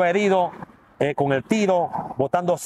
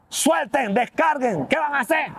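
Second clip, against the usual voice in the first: higher barked order, a police captain stopping his men; retold order from the captain at the scene, imitated; captain's command; 8 semitones higher, slower than usual. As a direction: call out to the officers to stand down.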